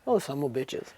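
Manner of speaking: imitated low, funny cursing. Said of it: "He loved to swear".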